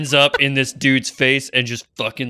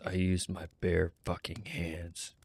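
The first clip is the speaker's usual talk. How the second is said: low menacing line; 6 semitones lower, 13 dB quieter, slower than usual. Acted. Roll answers coldly, in character.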